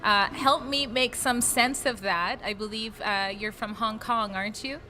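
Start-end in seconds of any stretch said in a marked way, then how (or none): none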